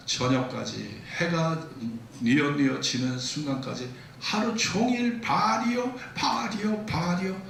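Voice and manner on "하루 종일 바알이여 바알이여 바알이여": crying out to Baal. Acted. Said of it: "그렇게 외쳐대고 외쳐대고".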